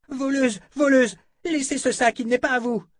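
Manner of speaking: put-on high young voice, accusing; panicked boy. A narrator's reading. Young man catches intruders, high panicked accusing. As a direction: yell thief at the intruders, high.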